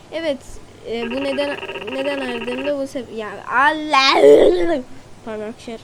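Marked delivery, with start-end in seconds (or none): 3.2-4.8 slow, loud frustrated groan; sinirli inilti. Real, not acted. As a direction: drag it out in exasperation. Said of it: "Bir de biraz sinirlendim". (yavaş ve yüksek, sinirle uzatılmış ya Allah)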